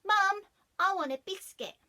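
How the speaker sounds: put-on high, loud child begging; little girl's voice. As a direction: pipe up like a small hungry girl. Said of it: "the lady's daughter said".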